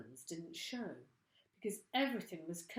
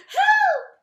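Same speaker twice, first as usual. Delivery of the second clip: shrill high scream; screaming for help; 24 dB louder than usual. Acted. Missus Twit screaming at the thing in bed. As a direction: scream it in panic. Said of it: "screamed Missus Twit".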